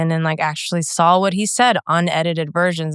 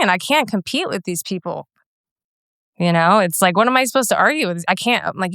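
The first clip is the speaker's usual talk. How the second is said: ordinary delivery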